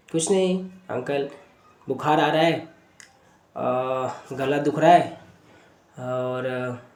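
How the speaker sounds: softly voiced reluctant patient, trailing off; hesitant child. Sick boy reluctantly answering the doctor's questions, hesitant and subdued. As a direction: give symptoms quietly, haltingly. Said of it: "थोड़ा hesitate है बताने के लिए".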